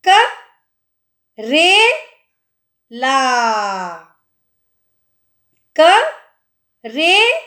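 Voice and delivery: करेला drawn out with a rise, very slowly; slow sounding out. Shown as a demonstration. Teacher sounding out करेला for pupils. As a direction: stretch करेला syllable by syllable. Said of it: "र में कौन सी मात्रा आ रही है".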